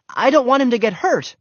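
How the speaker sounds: high, loud worried protest; pleading explanation. A narrator's reading. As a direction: plead for your dog. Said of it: "Henry tried to explain".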